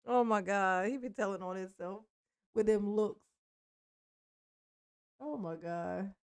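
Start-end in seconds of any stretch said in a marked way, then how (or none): none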